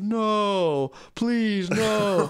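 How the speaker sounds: high, pleading mock helplessness; playing dumb. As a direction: whimper like a helpless fool, hammed up.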